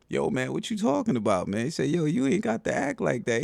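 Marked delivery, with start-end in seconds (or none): 0.1-1.6 higher-pitched mock confrontation; high, incredulous retold challenge; imitating a challenger. Acted bystander challenging the barber. 1.9-3.4 high-pitched mock protest; retold challenge pitched way up; imitating a challenger. Acted bystander challenging the barber.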